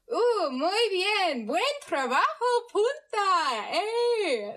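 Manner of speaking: high, drawn-out, hammed-up Spanish cheer; attempted Spanish accent. Shown as a demonstration. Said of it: "actually trying to do an accent".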